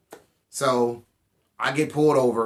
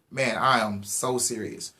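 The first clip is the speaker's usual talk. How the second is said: normal talking voice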